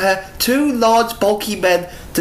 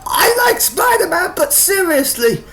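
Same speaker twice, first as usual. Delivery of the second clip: exasperated shout; 12 semitones higher than usual. Real, not acted. Reacting to crude panel.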